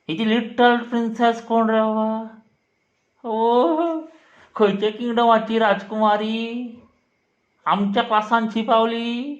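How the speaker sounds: mock awe. Teacher ridiculing a pupil's princess screen name, mock awe. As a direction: tease with exaggerated, high-voiced amazement.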